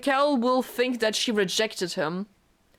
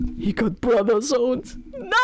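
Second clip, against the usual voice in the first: dismayed wail. Real, not acted. Streamer wails at brother-zone.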